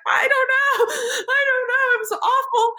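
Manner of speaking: very high, wailing retold sob; mock crying. Upset plebe answering her teacher, mock crying. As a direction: wail it in a hammed-up tearful voice. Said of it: "I was, like, in tears".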